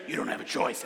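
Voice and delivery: menacing hustler. Retold or imitated. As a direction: loom over him with a mock threat. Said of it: "And he's like".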